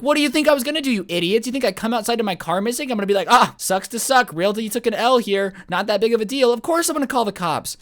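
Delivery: high-pitched, indignant telling-off; mock-outraged rant at foolish friends; exasperated rant. Retold scolding of prankster friends.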